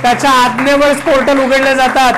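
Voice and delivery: impassioned preaching. Real, not acted. Preacher telling the congregation why the command opened heaven's portal, preaching forcefully. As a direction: drive each phrase home at full voice.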